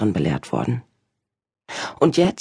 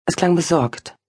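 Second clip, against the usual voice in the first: ordinary delivery, nothing marked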